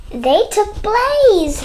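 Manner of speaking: high slow alarmed read; alarmed cry. Acted. Sam alarmed as Blaze is driven off. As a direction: gasp it out as the truck leaves. Sam sees truck take dog.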